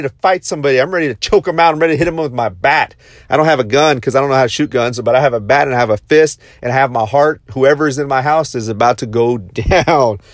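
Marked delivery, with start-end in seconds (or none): none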